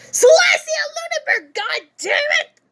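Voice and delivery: sudden high, loud yell; hammed-up furious British lady yelling; Celestia Ludenberg. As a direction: erupt into a shrieked outburst. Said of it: "That was a terrible yell".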